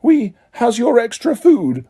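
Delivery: high, slow squeaky elf voice; an elf pressing food on Harry. A narrator's reading. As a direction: squeak it like an eager little elf. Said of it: "squeaked an elf at Harry's elbow".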